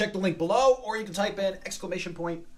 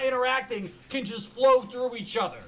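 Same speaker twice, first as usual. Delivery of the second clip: ordinary talk, nothing marked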